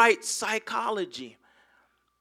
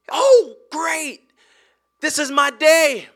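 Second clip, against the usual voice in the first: mock delight; 6 semitones higher, 8 dB louder, slower than usual. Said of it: "He didn't say".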